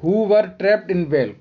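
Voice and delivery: very slow, clear read of the instruction; slow reading aloud. Shown as a demonstration. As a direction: dictate the instruction to the class. Reads question slowly. (बहुत धीमे पढ़ते हुए)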